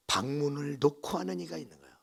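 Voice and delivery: ordinary talk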